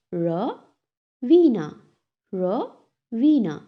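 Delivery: "Ravina" slowly split as ra, vina; long pause after the first syllable of "Ravina". Shown as a demonstration. Teacher breaks a pupil's name, "Ravina" in two parts. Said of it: "break this word into two syllable".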